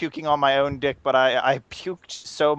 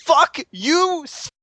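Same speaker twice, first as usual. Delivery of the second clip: raging, higher, snarled out; enraged drunk; 12 semitones higher, 3 dB louder, much slower than usual. Retold or imitated. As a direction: spit it at him in a rage. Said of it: "just being filled with rage".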